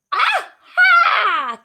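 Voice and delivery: high, loud triumphant witch cackle. Acted. Wicked witch gloating over Beauty, loud high cackle. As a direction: cackle like a wicked witch who has won. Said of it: "cackled the wicked witch".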